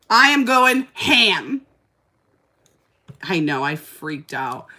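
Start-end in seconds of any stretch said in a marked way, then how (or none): 0.1-1.6 loud, high, drawn-out declaration; hammed-up frenzy, stretching each word; worked-up retelling. Telling viewers how she went wild on the bugs, worked up. Acting out her past freak-out.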